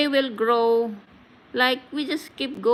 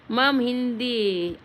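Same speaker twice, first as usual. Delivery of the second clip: ordinary talk, nothing marked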